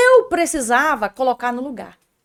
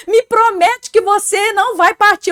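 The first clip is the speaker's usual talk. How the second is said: a mock tearful plea, higher and louder; imitating husband crying; 8 semitones higher, 8 dB louder, slower than usual. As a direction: play the weeping husband begging, lightly. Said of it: "Mas ele chorava copiosamente". (marido chorando pede à esposa que não parta, imitação leve de choro)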